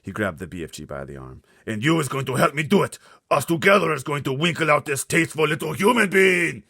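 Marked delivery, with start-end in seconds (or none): 1.7-6.6 huge loud high giant shout. Acted. Blood Bottler ordering the BFG, shouting. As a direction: bellow the order. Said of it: "he shouted".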